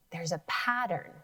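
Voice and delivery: usual speaking voice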